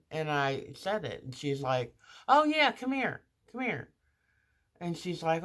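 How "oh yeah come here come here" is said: a bright, much higher nurse voice calling her over; perky come-here call, pitched way up; chirpy nurse call. Retold or imitated. Nurse in pre-op calling the patient over, bright and high.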